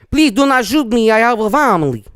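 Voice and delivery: hammed-up plea for mercy; mock pleading soldier. Host joking about pleading Nazi soldiers, mock begging. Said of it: "we were like, 'Kill 'em.'".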